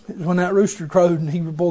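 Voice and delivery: usual speaking voice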